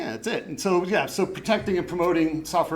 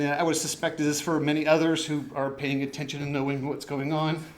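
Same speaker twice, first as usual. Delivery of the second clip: ordinary talk, nothing marked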